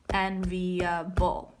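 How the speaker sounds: slow, syllable by syllable Enviable; syllables counted out. Shown as a demonstration. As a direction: tap out En-vi-a-ble one beat at a time. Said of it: "that's four".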